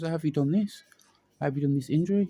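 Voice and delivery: high, disbelieving doctor's question; shocked doctor. Retold or imitated.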